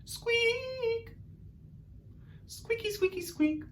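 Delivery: extremely high, soft squeaky squeaks; Artie squeaks. Shown as a demonstration. Teacher leads the class in Artie squeaks, very high squeaks. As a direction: squeak like a tiny mouse. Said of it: "our best Artie squeaks".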